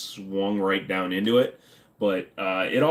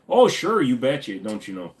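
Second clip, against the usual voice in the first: hammed-up Minnesota "you betcha" accent; Fargo-style Minnesota accent; 3 semitones higher, 4 dB louder than usual. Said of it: "Isn't Fargo a documentary".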